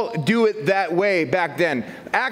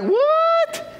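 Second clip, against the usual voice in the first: high squeaky what; surprised. Retold or imitated. Newly saved Italians baffled by the Spirit, high surprised. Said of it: "and they're like".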